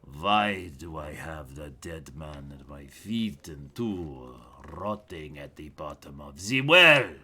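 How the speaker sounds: a watch captain holding back threat, hushed then flaring; city watch captain. Acted. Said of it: "looks at you and goes".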